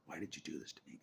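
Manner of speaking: usual speaking voice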